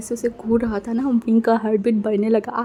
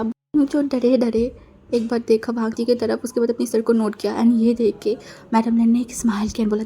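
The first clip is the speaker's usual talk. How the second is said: plain reading voice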